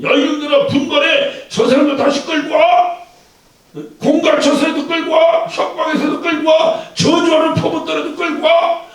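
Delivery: rally them, shouting to drag people back; rousing shout. Acted. Preacher acts out rousing call.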